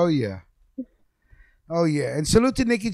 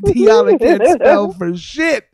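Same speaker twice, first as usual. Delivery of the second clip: words squeezed out through real laughter; laughing; 5 semitones higher, 5 dB louder, slower than usual. Real, not acted. Teasing host laughing at his friend's spelling, laughing. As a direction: laugh it out slowly while teasing Diala.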